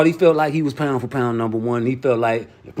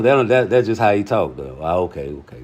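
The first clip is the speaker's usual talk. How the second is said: normal talking voice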